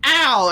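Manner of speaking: sudden mock cry of pain; imitated pained yelp. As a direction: yelp as if smacked by a door. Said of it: "and it's just".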